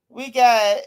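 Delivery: ordinary talk, nothing marked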